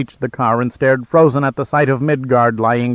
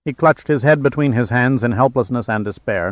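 ordinary delivery

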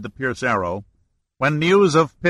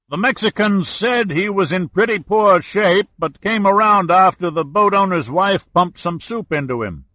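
higher, louder call; shouting; 7 semitones higher, 3 dB louder than usual. A narrator's reading. Owner shouting back to Giordino, loud call. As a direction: shout the answer across.

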